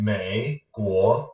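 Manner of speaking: MEI and GUO apart, each fully toned; slow "Mei", dip and rise, then separate rising "Guo"; separate tones. Shown as a demonstration. Teacher contrasting for learners the broken-up word, tones separated. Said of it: "what we can do, instead of saying".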